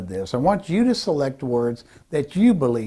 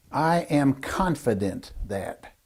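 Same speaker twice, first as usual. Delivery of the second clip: slow, softer statement of confidence; definitive; 5 dB quieter, slower than usual. Shown as a demonstration. Speaker coach models a power phrase, slow, soft and confident. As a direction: say it softly and unhurriedly, fully sure. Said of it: "hear what they sound like".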